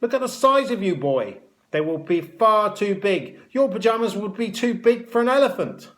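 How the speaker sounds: high, loud scornful matron; mock the boy's size for all to hear; ridiculing. A narrator's reading.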